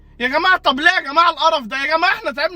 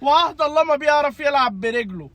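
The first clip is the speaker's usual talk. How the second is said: ordinary delivery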